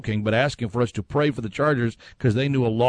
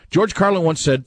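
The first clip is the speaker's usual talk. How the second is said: ordinary talk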